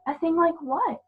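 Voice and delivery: put-on a child asking, confused; young girl Lucy.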